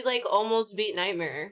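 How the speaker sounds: usual speaking voice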